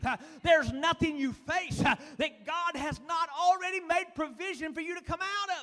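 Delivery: loud, driving preacher cadence; preaching at full force. Acted. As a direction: hammer the promise home to the congregation.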